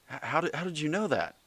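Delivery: high-pitched, stammering mock disbelief; astonished disciple. Acts out astonished disciple questioning Jesus.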